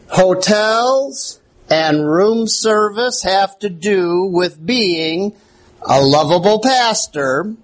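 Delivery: ordinary talk, nothing marked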